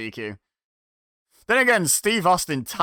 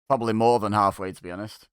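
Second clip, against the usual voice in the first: ordinary delivery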